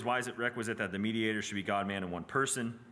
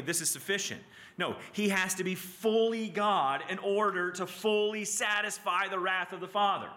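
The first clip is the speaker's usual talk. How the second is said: raised pitch, slowing to hammer the key words; impassioned preaching; 9 semitones higher than usual. Real, not acted. Teacher pressing on the class why the Redeemer must be both, impassioned preaching. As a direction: preach it with mounting insistence.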